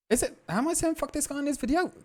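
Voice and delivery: very high mock voice of himself; mocking squeaky voice. Mocking his own words.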